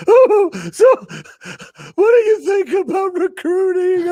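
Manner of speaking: high, teary mock voice; choked-up voice asking questions; crying interviewer. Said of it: "Chafin just in tears of joy".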